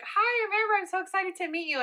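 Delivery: high, gushing hello to a new date; bubbly excited greeting. Retold or imitated. Amber meeting her date, high and gushing. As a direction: greet him like you can't contain yourself.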